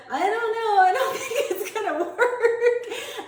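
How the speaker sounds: high, slow quote broken by laughter; can barely get the words out for laughing; grandma's doubt dissolving into giggles; laughing through the quote. Retold or imitated.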